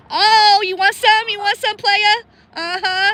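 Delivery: joking loud dare to a goose; yelling at geese. Real, not acted. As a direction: call out the goose like a street fighter. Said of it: "I'm yelling at the geese".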